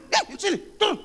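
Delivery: high, loud order to step down; hammed-up angry choir trainer; mock scolding. Choir trainer orders singer down, mock scolding. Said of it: "saya marahi".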